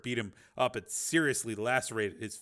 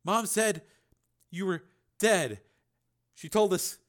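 put-on high, halting sedated boy; slurred, broken phrases, pitched up; thick, slurred voice; 9 semitones higher, 4 dB louder than usual. A narrator's reading. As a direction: struggle the words out, drugged and swollen-lipped. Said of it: "His voice was thick and slurred".

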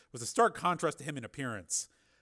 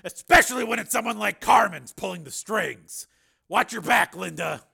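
higher, loud, insistent warning; forceful Steele; 8 semitones higher, 12 dB louder than usual. A narrator's reading. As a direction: caution a friend with force.

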